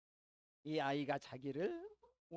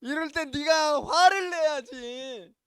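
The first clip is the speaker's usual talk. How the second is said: a father yelling, loud and high, at his son; anguished scolding outburst, loud and high; angry shout; 5 semitones higher, 13 dB louder than usual. Retold or imitated. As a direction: blow up in frustration at the boy. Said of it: "이 아빠는 막 화를 냈어요".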